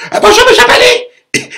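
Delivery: squeaky high sugary hello; a caricature of a man cooing at a neighbour; mock fawning greeting. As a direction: greet him like a fawning fool. Man greets poodle owner sweetly.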